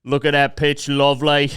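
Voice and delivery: chirpy British geezer catchphrase; imitated British admiration; mock British accent. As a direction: put on a broad British accent. Quoting the British video guy.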